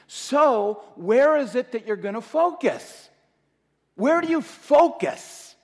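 loud, high preaching push; preaching at full force. Acted. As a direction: drive the question home to the crowd. Preacher builds to a loud plea.

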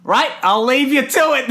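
high, over-chipper elevator man signing off; mock cheery. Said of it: "and he's so cheery".

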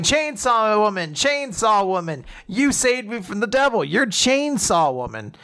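high, excited acclaim, imitating a fan; excited greeting. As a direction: gush at your rescuer in a high voice. Retold excited greeting of a rescuer.

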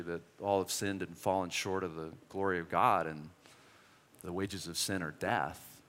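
hushed sincere preaching; quiet preaching. Acted. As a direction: bring the room down gently. Quiet sermon close.

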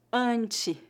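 "ante" with a nasal A; nasal A demo. Shown as a demonstration. Teacher to students showing the nasal A. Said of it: "pronúncia do A nasal".

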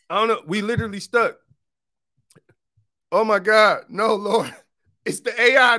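playfully panicked, as if trapped by the AI; mock panic. Joking that he is trapped on stream.

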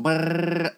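long rolled trill as a drum roll; mouth drum roll. Shown as a demonstration. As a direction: imitate a snare roll with your tongue. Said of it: "back to the drum roll".

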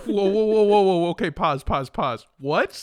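loud, rapid-fire calls to stop; startled interruption. Real, not acted. Stopping a friend's confusing joke, loud startled interruption. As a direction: cut in loudly to halt everything.

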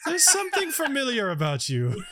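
high-pitched, teasing recognition; intrigued character. Acted. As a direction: size up a changed friend in a high voice. Same character eyeing friend's changed body.

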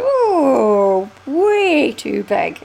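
retelling the hat try-on, slow loud dismay. Retold or imitated. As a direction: stretch it out like you just saw it's huge. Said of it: "and I went".

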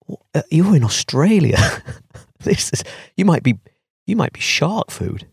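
high, half-joking panic inside his head; a sudden nervous thought; alarmed inner voice. Retold or imitated. Inner thought on seeing sharks.

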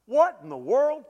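a husband's angry what in the world; mock outraged groom; indignant Jacob. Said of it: "He's like".

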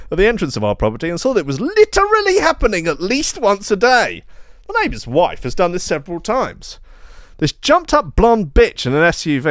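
high-pitched, put-on outraged reading; hammed-up high voice of a wronged homeowner; aggrieved complainant. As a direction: read the complaint like an indignant neighbour. Reads out neighbour's complaint post.

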